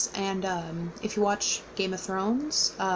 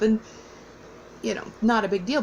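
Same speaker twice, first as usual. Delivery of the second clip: ordinary talk, nothing marked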